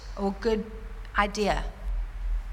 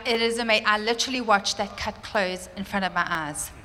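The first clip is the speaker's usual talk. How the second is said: normal talking voice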